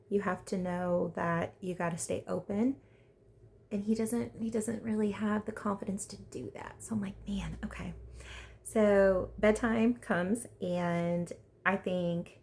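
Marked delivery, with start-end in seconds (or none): 5.5-7.9 breathy hushed confiding; whispered aside. Real, not acted. Vlogger whispering about Sam's lack of confidence. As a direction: whisper it like a secret.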